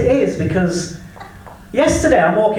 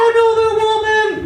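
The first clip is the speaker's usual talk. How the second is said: even higher, a stunned echo; mock disbelief; 13 semitones higher than usual. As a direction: repeat it like you can't believe it. Repeated in disbelief.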